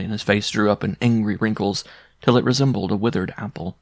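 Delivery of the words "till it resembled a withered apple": ordinary delivery, nothing marked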